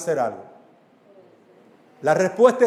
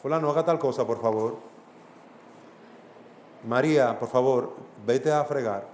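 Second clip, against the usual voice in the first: soft, lower, gentle request; 5 semitones lower, 6 dB quieter than usual. Shown as a demonstration. Preacher showing parents how to give a child an order, soft and gentle. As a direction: ask kindly and quietly, no raised voice. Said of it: "un tono suave".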